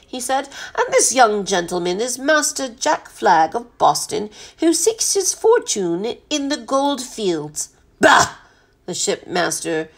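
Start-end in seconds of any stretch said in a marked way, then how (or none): 0.8-7.7 formal, ceremonious butler introduction; butler's measured, courtly delivery; courteous butler. Acted. 8.0-8.4 high, loud dismissive burst; blustery captain's contempt; scornful bah. A narrator's reading.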